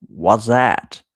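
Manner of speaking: loud, puzzled American-style reaction; mock American bewilderment, punched out; imitated baffled American. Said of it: "the American guy's reaction was".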